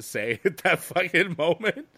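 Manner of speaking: words breaking into laughter; laughing. Real, not acted. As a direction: laugh through the line. Laughing at the awkward memory.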